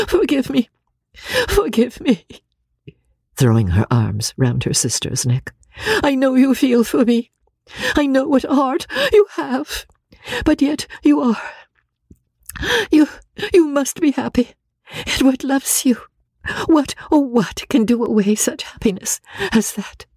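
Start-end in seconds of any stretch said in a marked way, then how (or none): 0.1-2.3 high, remorseful plea; tearful begging. Acted. Marianne begs sister's forgiveness. 6.0-20.1 very high, tormented wailing voice; distraught Marianne. Acted. Marianne distraught to her sister.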